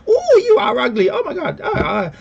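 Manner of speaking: high, loud squeal of dismay; mock horror. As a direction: recoil aloud in hammed-up shock. Acted shocked reply, mocking ugliness.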